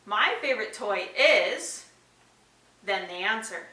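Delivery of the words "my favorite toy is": put-on slow, leaving it open for the child; slow sentence starter. Shown as a demonstration. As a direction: model the starter slowly, rising. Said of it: "sentence starters".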